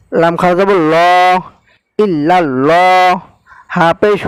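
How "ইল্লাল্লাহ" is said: slow recitation. Shown as a demonstration. Teacher recites Quranic word slowly.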